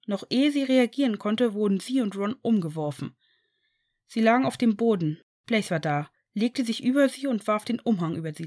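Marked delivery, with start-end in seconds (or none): none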